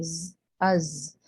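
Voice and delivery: ز drawn out very slowly with a long buzz. Shown as a demonstration. Teacher stretching the za buzz for students. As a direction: let the ز of از buzz, held long. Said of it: "زا کی buzzing واضح رکھیں".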